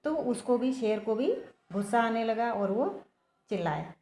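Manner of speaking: ordinary delivery, nothing marked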